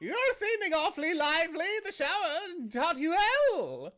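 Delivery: high, loud yelled banter; panicked shouting. Acted. Jeremiah being chased by Shriek, high panicked shout. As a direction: shout it high as you flee.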